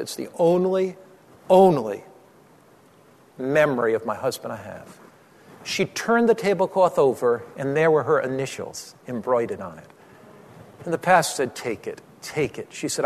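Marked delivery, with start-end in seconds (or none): 0.0-5.0 understated grief, halting on the repeated word; low, broken by long pauses, mildly tearful; retold crying. Woman clutching her husband's tablecloth, retold crying. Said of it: "crying, and said". 11.5-12.7 hushed, low insistence; gentle urging. Retold or imitated. Pastor offering the tablecloth to the crying woman, gentle urging.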